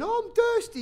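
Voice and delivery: put-on very high, loud child's voice; whining child imitation. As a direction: mimic a kid's bedtime plea. Mimics child's bedtime excuse.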